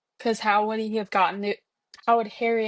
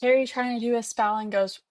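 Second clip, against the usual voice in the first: usual speaking voice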